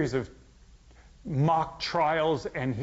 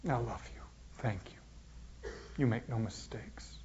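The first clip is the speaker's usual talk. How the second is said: soft, breathless whisper; a hushed prayer of trust in the worst moment; 3 semitones lower, 9 dB quieter than usual. Retold or imitated. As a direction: whisper it like an intimate prayer to the Father. Jesus praying in his agony.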